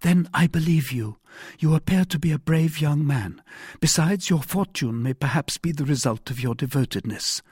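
high-pitched woman, earnest; trusting heroine. A narrator's reading. Wife tells the brave young man she believes him, trusting. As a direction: grant trust in a lifted female voice.

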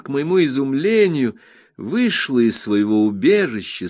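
plain reading voice

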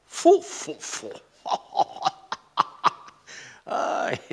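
puffed exclamation breaking into laughter; tickled, lecherous chuckling; relishing laughter. Acted. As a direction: puff "fu-fu-fu", then laugh with relish. Relishing memory of a pretty maid.

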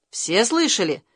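ordinary delivery

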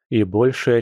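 plain reading voice

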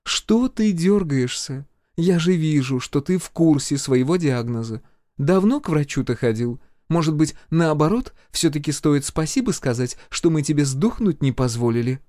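high-pitched, mocking needling; snide healer. A narrator's reading. Healer taunts the twitchy major about his diagnosis, snide. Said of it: "в голосе целительницы сквозило ехидство".

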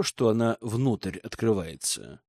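usual speaking voice

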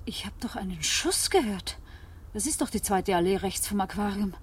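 normal talking voice

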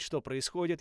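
ordinary delivery

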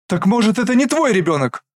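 high, loud sudden outburst; sudden exclamation. Acted. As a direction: blurt it out in shock. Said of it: "Внезапно восклицает мама". (внезапный возглас)

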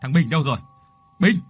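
loud calls of a name into the night; calling out. A narrator's reading. Villagers call for the missing madman, loud. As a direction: shout his name across the lane.

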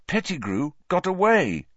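high, bitter complaint with some force. A narrator's reading. Pupil telling headmaster the traitor escaped, bitterly. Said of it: "said Harry bitterly".

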